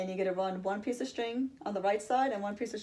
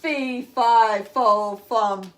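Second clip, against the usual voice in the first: much louder roar, each word hammered; roaring giant; 13 dB louder, much slower than usual. Acted. Giant chasing Jack down the hall, roaring. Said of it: "roared the giant".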